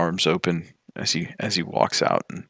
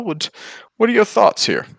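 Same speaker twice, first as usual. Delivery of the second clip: high pleading question; 9 semitones higher, 4 dB louder than usual. Acted. Grandfather asks the party.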